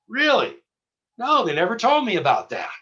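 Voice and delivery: sudden high startled "Really?"; surprised. Retold or imitated. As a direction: caught off guard by the news. Agent shocked by news.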